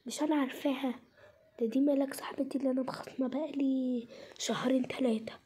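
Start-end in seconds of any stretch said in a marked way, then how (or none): 1.6-5.3 put-on whispered gossip about the girl nearby. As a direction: lean in and whisper it. Spotting estranged friend, secretly. (همس لصاحبتها عن ملاك)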